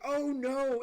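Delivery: high, loud "oh no" of panic; panicked cry. Retold or imitated. Friends realizing the chips are on fire, panicked loud cry. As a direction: cry out as it dawns on you. Seeing the oven smoking.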